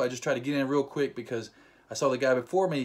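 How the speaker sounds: ordinary talk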